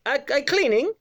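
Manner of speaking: put-on high-pitched Eastern European accent; Eastern European woman. Cleaner stopping a man at the toilet door, imitated Eastern European accent. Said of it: "I can do her voice".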